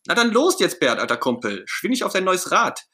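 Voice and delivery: high, bursting with eagerness; raring-to-go Ernie. Acted. Ernie challenging his pal Bert to a bike race, raring to go. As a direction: launch in high and eager, unstoppable. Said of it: "Ernie ist kaum noch zu bremsen".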